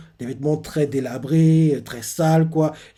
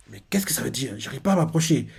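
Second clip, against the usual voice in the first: soft, hushed inner voice; inner thought; 3 semitones lower, 6 dB quieter than usual. Retold or imitated. Bonnie wondering to herself why she can't reach him, hushed. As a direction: think it to yourself, barely aloud. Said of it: "se dit dans sa tête".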